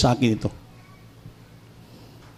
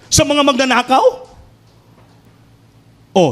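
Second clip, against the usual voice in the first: fiery preaching; 10 semitones higher than usual. Acted. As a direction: preach it with rising fervour to the church. Preaching at full force. (masidhing pangangaral)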